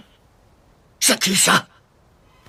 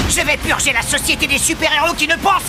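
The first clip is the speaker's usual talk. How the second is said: fierce declaration; 10 semitones higher than usual. Acted. Villain shouting his creed at the students. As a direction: proclaim it like a zealot.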